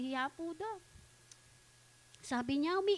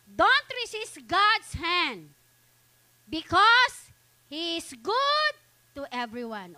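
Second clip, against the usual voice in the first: raised, emphatic preacher voice; forceful preaching; 3 semitones higher, 6 dB louder than usual. Acted. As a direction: drive the exhortation home to the congregation.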